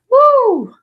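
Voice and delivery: a big high loud woo; excited whoop. Real, not acted. Reader cheering a viewer to go find love, loud high whoop. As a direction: cheer them out the door with a whoop.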